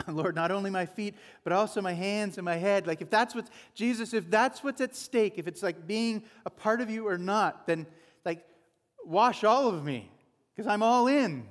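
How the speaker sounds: building to a loud wash all of me; eager Peter. Retold or imitated. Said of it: "So Peter says".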